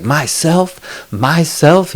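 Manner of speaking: high offended repeat of the word; indignant echo. Retold or imitated. Retelling the husband on the phone, high indignant echo. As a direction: pitch up in outraged disbelief.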